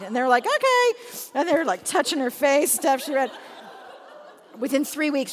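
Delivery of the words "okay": high, loud sing-out reply; agreeable little kids. Retold or imitated. Kids answering mom reading her Bible, childlike okay. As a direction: chime it like small children.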